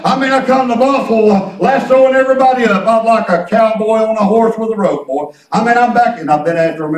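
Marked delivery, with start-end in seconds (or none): none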